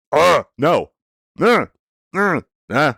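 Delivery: high, loud frustrated ughs; frustrated groans. Real, not acted. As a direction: groan out in exasperation. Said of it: "I'm getting worked up again".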